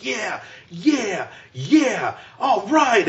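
high, thrilled yeah yeah yeah; cheering himself on. Retold or imitated. Kid celebrating what he thinks is a home run, high and excited. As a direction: replay the proud kid celebrating too early.

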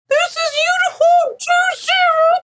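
very high, slow squeezed radio call; funny pursed-lip voice. Acted. Pilot imitating her oxygen-mask radio call, funny pursed-lip voice. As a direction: make the radio call through pursed lips. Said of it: "pursing your lips and speaking in a very funny voice".

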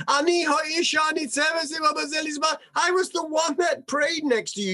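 high, fast, outraged pleading; indignant protest. Acted. Hannah protesting to Eli.

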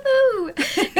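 high, bright woohoo; excited cheer. Acted. Cheering like the toddler.

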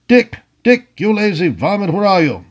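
high-pitched impatient call to a servant; shouting. A narrator's reading. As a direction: call out crossly through the door. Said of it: "he shouted".

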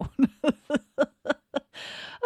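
high, bright burst of laughter; real laugh. Real, not acted. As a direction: let the laugh bubble out. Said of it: "it just makes me laugh".

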